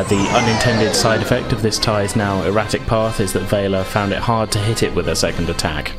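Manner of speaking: ordinary talk, nothing marked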